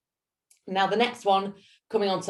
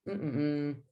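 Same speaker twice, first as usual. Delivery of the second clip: tongue-circling hum; 6 dB quieter than usual. Shown as a demonstration. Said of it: "So just trying a".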